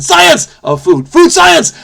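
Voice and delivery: high, loud, held-out cheer; exuberant exclaiming. Pretend. Solver geeking out over browning, exclaiming loudly. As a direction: proclaim it with mock triumph, leaping up in pitch.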